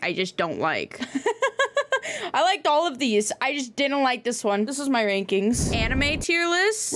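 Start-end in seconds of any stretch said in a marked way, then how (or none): 1.0-2.3 high giggle. Real, not acted. Laughs at disliked movie.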